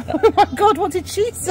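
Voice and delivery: laughing. Real, not acted. Laughing out loud at his old spray-tan photo. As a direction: crack up mid-sentence.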